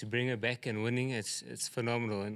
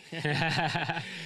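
short bright burst of laughter; amused chuckle at the suggestion another rider would have won; real laugh; 6 semitones higher, 4 dB louder than usual. Real, not acted. Jockey laughs at joke about rider.

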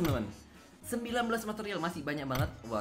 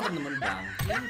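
ordinary talk

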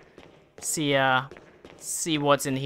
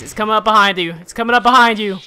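urgent warning; 4 semitones higher, 7 dB louder, faster than usual. Real, not acted. Warning character of danger.

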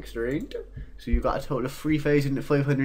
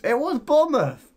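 outraged shout; 14 semitones higher, 7 dB louder than usual. Real, not acted. Outraged reveal.

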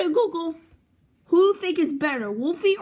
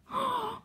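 excited gasp; 10 semitones higher, 6 dB quieter than usual. Acted. Sees the new gadget.

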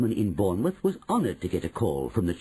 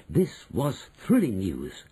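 plain reading voice

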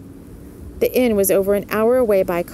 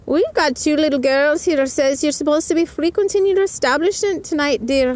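Missus O'Malley voice; 8 semitones higher than usual. A narrator's reading. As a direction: lift the pitch way up and brighten. Missus O'Malley speaks to Mellie.

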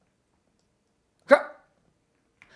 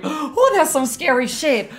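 scared; 6 semitones higher, slower than usual. Real, not acted. Reacting to scary scene.

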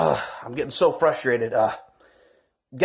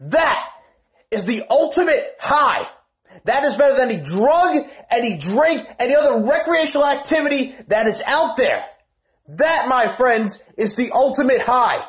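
preacher-style promo; 7 semitones higher than usual. Acted. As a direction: preach it to the crowd. Promo preaching at high pitch.

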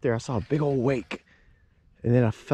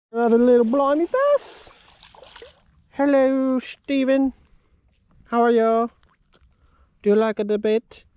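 much higher, put-on British-ish voice for the little bass; silly fish voice; 11 semitones higher, 3 dB louder than usual. Angler greeting a little bass he caught in a silly high British-ish voice. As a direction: squeak it out like a cartoon Brit greeting a fish.

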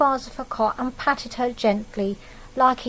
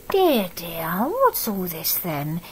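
put-on lower, slow and gentle, a kind old neighbour; 4 semitones lower, slower than usual. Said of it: "he said in a very kind voice".

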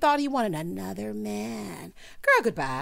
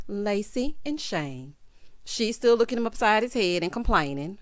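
usual speaking voice

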